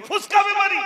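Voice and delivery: high, loud, irritated snap at the son; mother annoyed that her son keeps raising alarms; angry mother. Retold or imitated. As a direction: scold the boy sharply. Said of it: "ছেলের উপরে রেগে গেছে".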